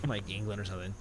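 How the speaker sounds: usual speaking voice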